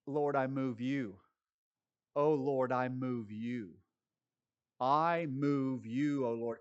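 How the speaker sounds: drawn-out, slow mock worship line. Preacher mocking a worship leader who thinks he moves God, slow. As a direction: stretch each word like a syrupy worship leader.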